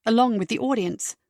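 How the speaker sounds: plain reading voice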